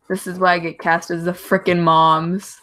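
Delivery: ordinary delivery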